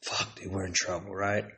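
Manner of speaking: very soft, low, half-whispered aside; imitated secretive worry, barely voiced; hushed retold dread. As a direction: say it under your breath, as if caught. Friends dreading being caught, under breath.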